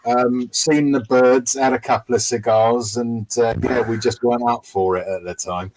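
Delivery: put-on Cockney lad telling a story; Cockney accent. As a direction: slip into a laddish Cockney accent. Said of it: "doing it in a Cockney accent".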